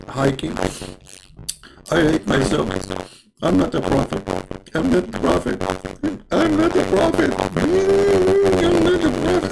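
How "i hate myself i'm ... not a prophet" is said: whiny self-pity climbing to a shrill high wail; hammed-up despair, rising to a squeal; a comic prophet wailing that he is not a prophet; mock wailing. Muhammad voiced wailing in self-pity.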